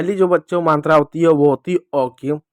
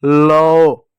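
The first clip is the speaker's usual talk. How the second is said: louder लो; loud demo syllable; 6 dB louder than usual. Shown as a demonstration. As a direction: call out लो. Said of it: "होगा". (ज़ोर से लो)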